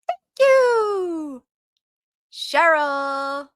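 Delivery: way up high and loud, sung out; bright thank you. Pretend. Reading a chat comment back to viewers, a high, loud chirpy thank you. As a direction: chirp it like a cheery greeting.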